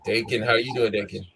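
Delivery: ordinary delivery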